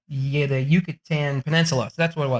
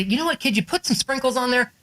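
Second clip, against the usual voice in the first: ice cream customer; 6 semitones higher than usual. Acted.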